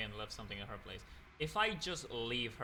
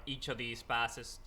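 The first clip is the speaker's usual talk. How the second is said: ordinary talk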